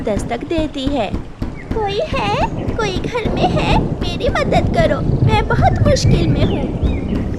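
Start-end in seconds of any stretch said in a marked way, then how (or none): 1.7-7.4 high, louder desperate call at the door; mother bird begging for help. Acted. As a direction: cry out for someone to open up. Bird knocking, calling for help.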